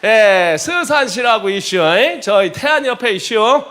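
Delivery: loud, high-spirited Chungcheong country talk; Chungcheong dialect. Pretend. Preacher joking with Seosan congregants in a loud put-on Chungcheong dialect. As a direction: chat like a jolly Seosan local. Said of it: "충청남도에서".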